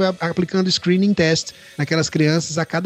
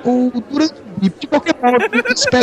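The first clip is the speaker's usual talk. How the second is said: sudden high shout; 6 semitones higher than usual. Retold or imitated. Punchline burst.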